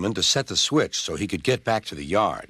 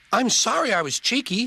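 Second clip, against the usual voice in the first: high-pitched, contrite small voice; sheepish, in a raised little voice; apologetic little engine; 12 semitones higher than usual. A narrator's reading. Little engine apologises to bigger one.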